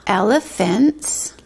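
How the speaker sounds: drawn out, long pauses between words; dictating slowly while printing. Shown as a demonstration. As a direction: say each word very slowly while writing. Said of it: "writing it on the board".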